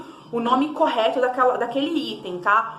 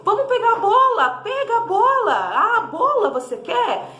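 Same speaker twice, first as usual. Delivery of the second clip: put-on high, bright sing-song to a small child, louder; talking to a toddler; 4 semitones higher, 4 dB louder, slower than usual. Shown as a demonstration. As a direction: coax the child toward the ball, sing-song. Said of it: "você fala". (voz animada de mãe brincando com a criança)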